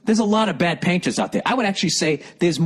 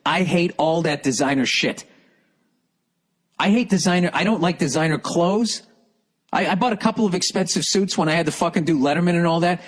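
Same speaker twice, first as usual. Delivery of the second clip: usual speaking voice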